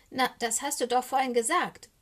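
lightly voiced insistent raven; cawing raven. A narrator's reading. Socke reminds Eddie what he said, insistent raven voice. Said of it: "krächzt Socke".

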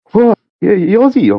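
high-pitched mock Aussie questioning; hammed-up Aussie bloke checking on a stranger; loud Aussie bloke. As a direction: put on a startled Aussie, way up high. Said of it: "this Aussie comes out".

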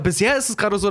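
ordinary delivery

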